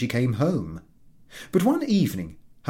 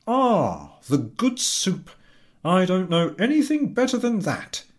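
higher, louder delighted husband; a pleased husband savouring his soup; husband's voice; 9 semitones higher, 4 dB louder than usual. Acted. Husband over dinner, higher and delighted.

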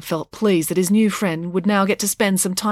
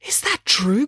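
breathless, higher-pitched shock; acted stunned disbelief; gasped; 5 semitones higher, slower than usual. A narrator's reading. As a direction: gasp out the question. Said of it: "gasped Loretta".

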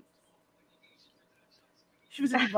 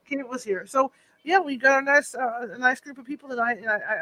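ordinary talk

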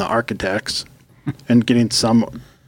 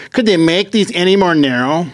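higher-pitched mock indignant shopper; irritated complaint; 6 semitones higher, 3 dB louder than usual. Shopper griping to a lady at the next checkout, mock irritated. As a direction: imitate an exasperated complaint, pitched up.

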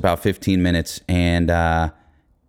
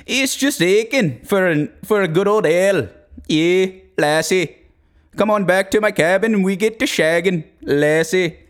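high, hammed-up Irish brogue; mock Irish drinker; Irish accent; 8 semitones higher than usual. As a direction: play up the Irish heritage in a put-on lilt.

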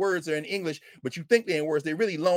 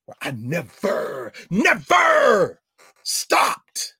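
loud, drawn-out cry of NEVER; emphatic outburst; 7 dB louder, much slower than usual. Retold or imitated. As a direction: belt it out, stretching the words. Emphatic outburst in retold story.

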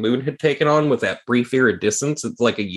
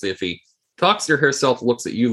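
usual speaking voice

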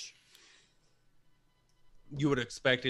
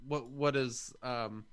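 ordinary delivery

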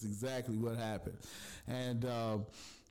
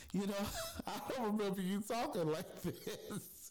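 high-pitched, laughing mock challenge; high teasing retelling through laughter; challenging friend; 9 semitones higher than usual. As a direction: imitate a friend calling her out, laughing. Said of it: "Hooli challenged her".

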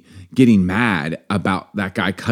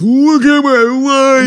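high, slow, loud hammed-up wail; slow, hammed-up self-pitying wail; mock lament; 6 dB louder, much slower than usual. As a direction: moan it like your life is ruined.

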